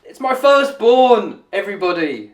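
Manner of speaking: high, drawn-out shout of a proud dad; proud announcement. Acted. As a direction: show off your son to a crowd, loudly. Proud dad announcing son to crowd.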